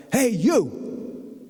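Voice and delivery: sharp high call of Hey, you; yelled call. Retold or imitated. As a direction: call out to a stranger across the hall. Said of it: "he yells out".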